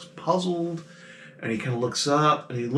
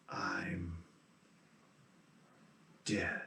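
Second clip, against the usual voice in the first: very soft, slow, lower ghost voice with a long pause; hollow ghost whisper; 6 semitones lower, 18 dB quieter than usual. Acted. Ghost Terrence telling the players he is dead, hollow whisper. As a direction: whisper it like a dead man just realizing. Ghost realizes his death.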